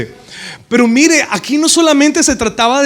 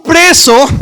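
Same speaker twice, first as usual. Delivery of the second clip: loud stress; 6 semitones higher, 7 dB louder than usual. Real, not acted. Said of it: "emphatic word".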